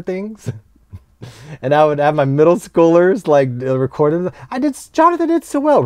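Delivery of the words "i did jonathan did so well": put-on much higher, quick, boastful child's voice; imitating a kid. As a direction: squeak it out like a proud middle schooler. Teacher voices middle schooler boasting.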